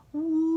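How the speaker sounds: high loud siren woo; high siren imitation; siren wail. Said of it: "With its siren wailing".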